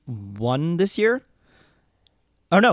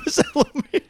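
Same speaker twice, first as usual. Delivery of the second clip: squeaky high repeat through laughter; high mock echo; 12 semitones higher than usual.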